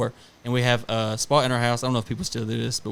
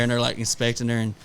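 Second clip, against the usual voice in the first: normal talking voice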